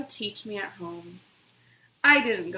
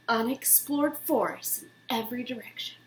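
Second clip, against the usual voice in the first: hushed whisper, a little quicker; 7 dB quieter than usual. A narrator's reading. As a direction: breathe it like a secret about the woods. Dramatic hush in narration.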